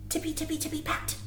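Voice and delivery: soft whisper of tiptoeing bunnies; whispered sneaking. A narrator's reading. Reading bunny footsteps to children in a whisper.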